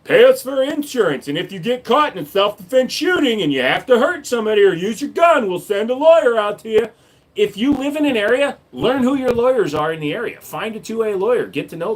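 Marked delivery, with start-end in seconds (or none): 0.0-9.2 mock the pushy sales pitch; mock insurance ad. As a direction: parody of a carry insurance ad, shouted. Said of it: "They advertise on YouTube".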